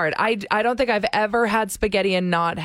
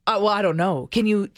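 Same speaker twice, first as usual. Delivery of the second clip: ordinary delivery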